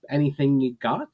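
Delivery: ordinary delivery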